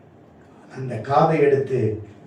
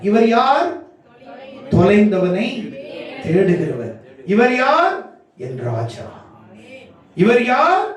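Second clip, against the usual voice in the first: rising questions climbing higher, answered in falling phrases; rousing preaching refrain; 4 semitones higher than usual. Real, not acted. Preacher rouses the congregation over who He is, climbing refrain. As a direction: fire out each question, then land the answer.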